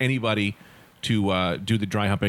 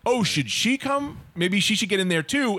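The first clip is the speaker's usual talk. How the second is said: put-on higher, slow teasing girl voice; playful girl; 8 semitones higher than usual. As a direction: play the girl suggesting more, higher and slow. S0 voices the girl inviting roommate.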